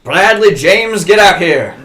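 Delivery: slow, loud summons of the son; calling out. Acted. Dad calling his son out of his room, loud. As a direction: bellow the full name down the hall.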